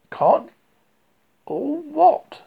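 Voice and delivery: Pooh's bewildered question; puzzled bear. Acted. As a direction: ask it like a baffled small bear.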